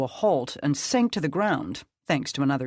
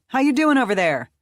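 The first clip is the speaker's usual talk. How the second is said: fast, loud, higher shouted question; calling out; 9 semitones higher, 7 dB louder, faster than usual. A narrator's reading. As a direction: call out to check on her brother. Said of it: "Krista called".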